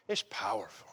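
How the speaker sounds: hushed, breathy aside; whisper. Real, not acted. Preacher whispers to congregation about touch, hushed whisper. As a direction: lean in and whisper it.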